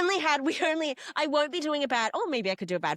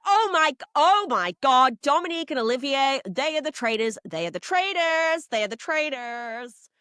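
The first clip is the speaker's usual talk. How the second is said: loud, high outburst easing off as it repeats; hammed-up astonished exposé, hammering each repeat; mock frantic revelation; 5 semitones higher, 4 dB louder than usual. Player exposing Dominique and Olivier, mock frantic. Acting out panicked round-table revelation.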